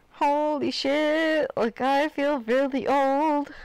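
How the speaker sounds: slow, high creaky complaint; old person voice. Retold or imitated. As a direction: drag it out like an old lady. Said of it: "I'm like".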